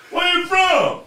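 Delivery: imitating a loud bartender, sudden high bellow; loud, aggressive. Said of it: "talk real loud".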